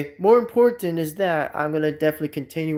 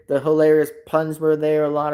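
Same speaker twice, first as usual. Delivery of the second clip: ordinary talk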